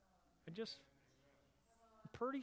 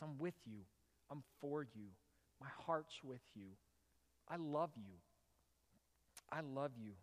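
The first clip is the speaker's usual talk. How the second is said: softer, gentle reassuring words; tender assurance; 4 semitones lower, 6 dB quieter than usual. Retold or imitated. God, to each listener, softly reassuring. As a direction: say it softly, like comforting a child.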